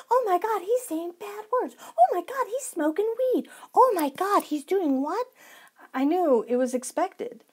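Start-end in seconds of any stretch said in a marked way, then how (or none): 0.1-5.3 hammed-up shocked prude; mock shocked gasping. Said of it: "I wasn't like".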